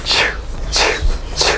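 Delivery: "ch" with no voice, just air; three separate voiceless "ch" bursts; voiceless consonant. Shown as a demonstration. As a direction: fire off "ch" without any vowel. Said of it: "No hay vibración".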